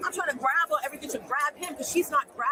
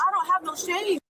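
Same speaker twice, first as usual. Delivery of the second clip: retold shout; 5 semitones higher than usual.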